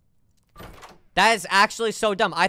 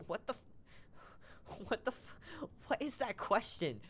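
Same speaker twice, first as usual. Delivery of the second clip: stifled laughing; 3 semitones higher, 17 dB quieter, slower than usual. Real, not acted. Laughing whisper.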